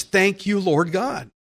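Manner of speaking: ordinary delivery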